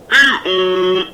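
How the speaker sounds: playful cat meow; mock meow. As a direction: meow like a cat again, deadpan. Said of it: "replied like this".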